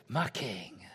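low imitation American accent; dodgy American accent. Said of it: "slightly dodgy American accent".

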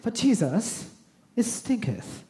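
hammed-up genteel, dainty objection; pinkies-up refinement. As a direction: say it with pinkies up, swooping and mannered. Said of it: "that's a pinkies up kind of phrase".

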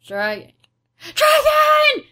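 sudden high yell of panic; panicked shout. Retold or imitated. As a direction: scream it in drunken panic. Drunk Mickey Rooney freaking out.

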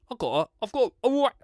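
high, cheeky market-trader patter; Del Boy impression. Podcast host hawking a deal, doing Del Boy. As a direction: sell it like a London wide boy. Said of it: "I feel like Del Boy".

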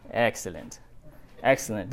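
low, soft scheming "Excellent, excellent"; Mister Burns. Retold or imitated. Rebekah gloating like Mister Burns, low and soft scheming. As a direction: purr it like a gloating villain. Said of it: "is like Mister Burns".

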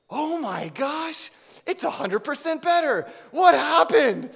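high, loud, slow oh my gosh; amazed woman. Retold or imitated. Healed woman telling the speaker her knee is better, high amazed gush. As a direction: gush like someone just healed. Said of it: "She goes".

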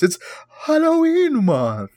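high, slow spooky drawl. Pretend. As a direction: draw it out like a ghost. Spooky drawn-out voice.

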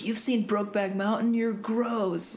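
mock-scandalized peer quote; mocking schoolkids. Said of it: "everyone was like".

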